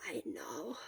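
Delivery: a sick man too tired to speak; weak whisper. A narrator's reading. Sick Shota whispering to his friend.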